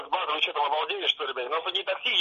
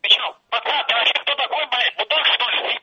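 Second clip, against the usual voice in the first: angry; 4 semitones higher, 8 dB louder than usual. Real, not acted. Driver angrily confronts the prankster.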